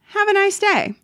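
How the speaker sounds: sarcastic farewell. Retold or imitated. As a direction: send him off with fake sweetness. Clerk dismisses rude customer.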